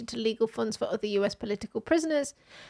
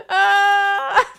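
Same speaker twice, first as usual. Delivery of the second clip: normal talking voice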